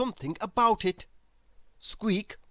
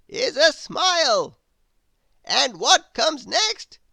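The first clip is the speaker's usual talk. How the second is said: put-on a grand old wizard, astonished; 10 semitones higher, 7 dB louder than usual. The Wizard puzzled at Grizzle's noises, high wizard voice.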